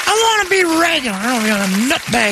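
very high, fast put-on whine; mocking whiny mimicry. Repeating Brady's accidental insult, mocking and high-pitched. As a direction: parrot it back in a shrieky high voice.

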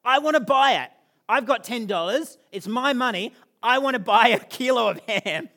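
put-on loud, high, insistent kid begging; worked-up kid. Dad retelling his son's loud plea for a kilo of ham. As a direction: demand it like a boy set on his ham. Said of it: "He's like".

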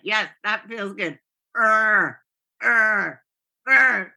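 flat "Yes, that feels good", then loud shouted "Er, er, er"; clumsy, loud fake ecstasy read straight off the page; literal ecstasy noises. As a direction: read the moan cues out loud like words. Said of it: "reading what was supposed to be ecstasy noises".

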